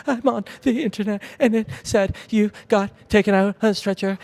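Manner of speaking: high, tearful retelling of a wife; imitated bawling. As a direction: mimic her crying through the news. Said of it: "like, she's bawling".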